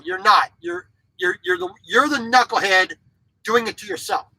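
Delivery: usual speaking voice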